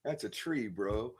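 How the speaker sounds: soft, laid-back bro voice; imitating chill guy. Retelling a friend's video, soft chill imitation. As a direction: drop it soft and mellow. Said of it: "he's all chill".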